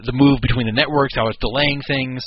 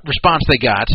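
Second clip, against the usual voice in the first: ordinary talk, nothing marked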